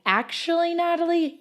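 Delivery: high, lightly voiced second thought; correcting inner voice. Second voice in her head answering the first, lightly correcting. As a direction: cut in high, as a gentle correction. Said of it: "the next voice comes in".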